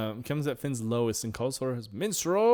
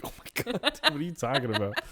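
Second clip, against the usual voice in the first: high, breathy mock disbelief, fast and laughing; a lightly voiced horrified Finn; Finn imitated; 8 semitones higher, faster than usual. Finn reacting to Jake, in a high mock-shocked voice. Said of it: "he was like".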